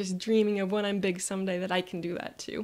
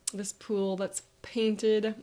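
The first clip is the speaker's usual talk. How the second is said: ordinary talk, nothing marked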